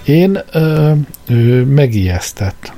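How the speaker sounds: hesitant, slow, halting admission; sheepish. A narrator's reading. Arthur admits to the old man he was startled, sheepishly. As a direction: stumble out the words, embarrassed. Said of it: "mondta félszegen".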